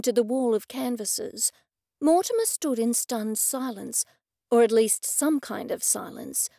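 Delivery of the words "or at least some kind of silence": ordinary delivery, nothing marked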